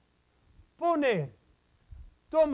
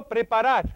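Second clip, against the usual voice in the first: PREPARAR said clearly and loudly, falling at the end; modelled for repeating; 5 dB louder than usual. Shown as a demonstration. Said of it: "Repita conmigo".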